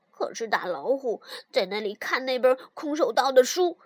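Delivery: plain reading voice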